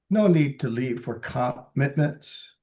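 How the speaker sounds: normal talking voice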